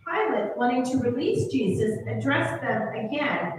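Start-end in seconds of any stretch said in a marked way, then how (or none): none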